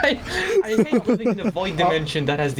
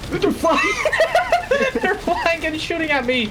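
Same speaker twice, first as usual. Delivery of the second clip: laughing panic; 3 dB louder than usual. Real, not acted.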